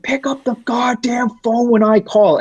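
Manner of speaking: high-pitched put-on yell of an angry manager; mock screaming boss. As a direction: squeal it like a ranting boss. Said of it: "he's screaming at me".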